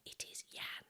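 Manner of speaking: whispered secret; boy whispering his name. Acted. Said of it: "He whispered".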